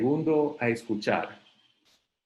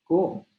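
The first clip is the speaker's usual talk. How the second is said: ¿Cómo? asked with a sharply rising, higher question tone; question intonation; 8 semitones higher, 4 dB louder than usual. Shown as a demonstration. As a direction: pitch ¿Cómo? up like a real question. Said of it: "un tono de interrogación". (¿Cómo? con tono claro de pregunta)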